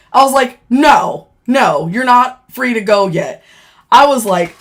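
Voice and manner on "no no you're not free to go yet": loud, commanding veto; retold firm refusal. Officer telling inmates they cannot leave yet, loud command. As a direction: lay down the order loudly.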